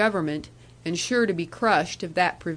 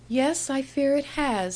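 higher, a touch of sadness; a hint of a sad young woman; lightly voiced sad young woman; 6 semitones higher than usual. Annie answers sadly.

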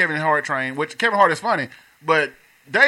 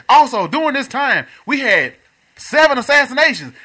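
comedian impression; 6 semitones higher than usual. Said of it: "he said".

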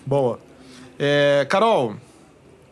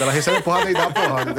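joking. Real, not acted. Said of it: "tô brincando".

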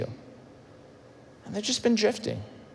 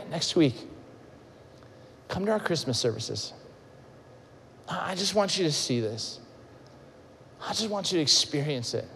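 gentle invitation; 3 semitones lower, 8 dB quieter than usual. Acted.

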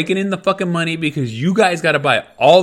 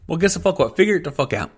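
normal talking voice

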